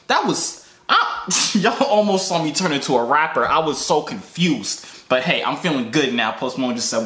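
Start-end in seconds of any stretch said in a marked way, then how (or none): none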